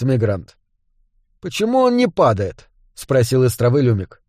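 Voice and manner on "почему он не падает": high-pitched, baffled question; wondering, pitched up; puzzled question. A narrator's reading. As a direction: ask it in mild bewilderment. Elf puzzled by the runner.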